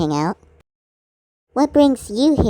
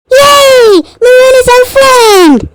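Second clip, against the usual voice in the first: high, loud joyful shout; loud happy celebration; excited cheer; 12 semitones higher, 12 dB louder, slower than usual. Acted. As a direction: cheer it out in triumph. Celebrating Maroon becoming friend.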